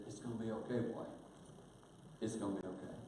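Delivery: quiet grandfatherly comfort; tender comfort. Retold or imitated. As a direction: soothe a frightened boy.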